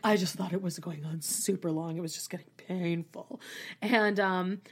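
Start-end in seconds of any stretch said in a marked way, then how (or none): 0.1-3.4 softer, lower mock Luann; mock Luann, quieter. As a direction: murmur it like Luann, lightly voiced. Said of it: "Luann's like".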